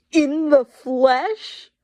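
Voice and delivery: high, very slow, drawn-out otherworldly voice; eerie creature voice. Acted. Reading a listener's submission in an eerie Lilith voice. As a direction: stretch it out like a demon in the room. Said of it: "after they said "in the flesh" a couple of times".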